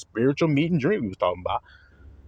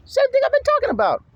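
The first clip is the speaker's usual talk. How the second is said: excited. Real, not acted. Preacher emphatic aside.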